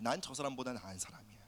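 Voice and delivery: low, hushed inner thought; private self-talk. Acted. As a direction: say it softly, as if to yourself.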